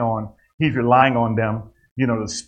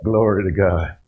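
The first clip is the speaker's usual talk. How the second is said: ordinary talk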